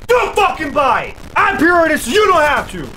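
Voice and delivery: high, loud furious yelling; raging shout. Real, not acted. Gamer raging at the game and the enemy, furious yelling. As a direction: scream it all out in a gaming rage.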